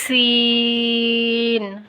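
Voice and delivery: سین as a whistled s with very long "ee"; firm, sustained سین; firm, slight whistle. Shown as a demonstration. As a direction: press the s of سین and stretch the vowel. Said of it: "تھوڑی سی سیٹی آئے گی".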